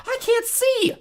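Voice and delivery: very high, loud cry; panicked yell. A narrator's reading. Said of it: "all three sisters yelled".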